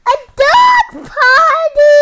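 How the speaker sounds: high, loud, slow delighted announcement; excited reveal. A narrator's reading. Parent reading to a child, revealing the dog party with delight. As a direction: unveil it like a big surprise.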